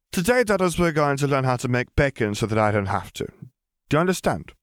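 put-on high, bossy little girl. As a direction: start over like a strict teacher. Lily starting over, firmer.

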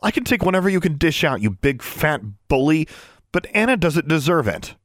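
defiant challenge. A narrator's reading. Defiant to bully.

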